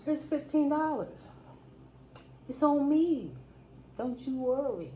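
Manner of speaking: treat her like she's family; generous reassurance. Retold or imitated. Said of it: "And I was like".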